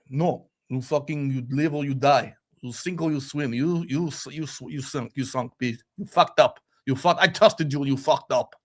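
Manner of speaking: a hint of a hard Eastern Bloc manager, building to a yell; cold tough-love manager, mildly voiced; harsh Eastern Bloc boss. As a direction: play the no-nonsense old-school boss scolding a failed player.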